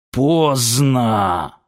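slow, drawn-out hoarse word; hoarse croak. A narrator's reading. Zur warns as he begins to transform, hoarse. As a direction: rasp it out in one long word. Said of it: "захрипел Зур".

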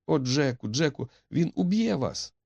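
high, fearful heroine; distraught warning. A narrator's reading. Etti fears Jack will be killed, high alarmed cry. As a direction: plead in alarm, voice lifted high. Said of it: "вигукнула розгублена Етті".

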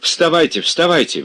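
high, loud alarm; frightened. A narrator's reading. Servant suddenly alarmed, rousing his master, frightened. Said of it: "вдруг испуганным голосом заговорил он".

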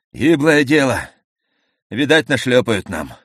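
high, loud shout that cracks through the nose; breaking nasal shout. A narrator's reading. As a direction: yell it with a torn, nasal voice. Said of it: "крикнул рвущимся и гундосым голосом".

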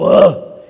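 loud caveman grunt from under the fur. Retold or imitated. Preacher acts out a caveman refusing to get up, loud grunt. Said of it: "He would just go".

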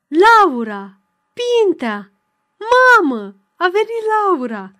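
loud, high, overjoyed calling out; childish shriek of joy. A narrator's reading. Girl shouting with joy as her sister's carriage arrives. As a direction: squeal the names like a delighted child. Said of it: "începu să țipe de bucurie copilăroasă".

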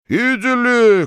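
whole class shouts it back; hurricane roar. A narrator's reading. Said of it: "как ураган ответили ученики".

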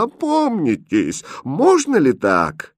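high, slow, aghast governess; horrified. Acted. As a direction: plead in slow, high-pitched horror. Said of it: "в ужасе прошептала гофмистерина". (в ужасе, протяжно и высоко)

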